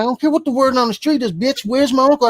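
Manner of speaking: high, fast retold yell at the church folks; snapping back at them; angry confrontation. Confronting the scam church people.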